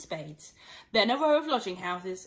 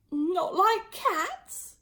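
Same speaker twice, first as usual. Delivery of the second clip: slow, much higher outraged squeak; shrill passionate mouse; 9 semitones higher, much slower than usual. Acted. Mouse indignant at Alice. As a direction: squeak it in disbelief. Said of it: "in a shrill, passionate voice".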